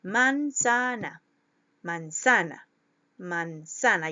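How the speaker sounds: "manzana" slowly, weight on a stretched "za"; stress on middle syllable. Shown as a demonstration. Teacher finding the stressed syllable, middle of "manzana" drawn out. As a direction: lean on the "za" of "manzana". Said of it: "mi intensidad de la voz".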